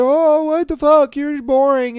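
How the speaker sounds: high, loud mock tantrum; mock upset date. Date mad at being turned down, mock upset. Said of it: "she got all mad and upset".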